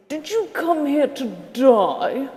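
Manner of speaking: put-on slow, posh-ish British officer accent. Officer asking the Australian bloke, in a British accent. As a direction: play the British officer in the joke, lightly. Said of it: "the British officer says".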